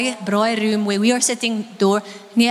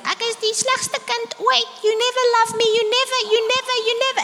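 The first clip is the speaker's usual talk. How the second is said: put-on whiny child; 12 semitones higher, faster than usual. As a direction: play the sulking child who feels unloved. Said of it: "Dan sal hulle sê".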